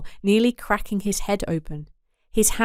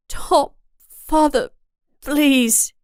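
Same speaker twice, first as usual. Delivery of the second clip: higher, louder slow plea; pleading daughter; 7 semitones higher, 4 dB louder, much slower than usual. Acted. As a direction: beg father to stop. Daughter begs violent father.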